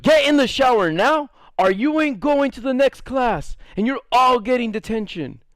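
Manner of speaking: high, firm mock order; stern threat. Teacher threatening the class with detention, firm order. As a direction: lay down the threat.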